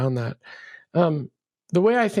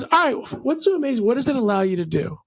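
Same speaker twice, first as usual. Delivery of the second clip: resigned friend; 6 semitones higher than usual. Retold or imitated. Friend giving in at bar.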